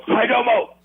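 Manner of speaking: "Hi domo" shouted high to the crowd; taunting catchphrase. Retold or imitated. Wrestler backing out of the corner, taunting "Hi domo". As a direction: sell "Hi domo" to the whole arena. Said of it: "and go".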